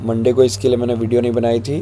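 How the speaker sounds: ordinary talk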